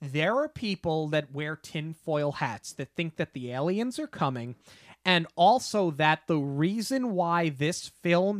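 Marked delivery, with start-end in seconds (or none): none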